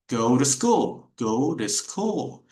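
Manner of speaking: "Go to school" slow and joined as one word; linked, slow. Shown as a demonstration. Teacher modeling go to school for Edgar. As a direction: glide "Go to school" together, unhurried. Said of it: "Exactly the same as go to bed".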